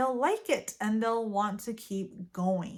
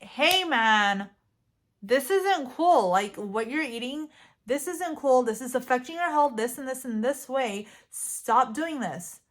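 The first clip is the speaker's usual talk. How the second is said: louder and firm, laying down the law; 4 dB louder than usual. Retold or imitated. Telling a loved one their eating isn't cool, firm. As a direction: stand up to them, no softening. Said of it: "develop a firmer spine and really stand up to them".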